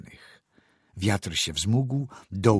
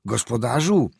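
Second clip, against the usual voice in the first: higher, louder call with alarm; 4 semitones higher, 6 dB louder than usual. A narrator's reading. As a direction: call out to the driver in dread. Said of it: "zawołał z trwogą".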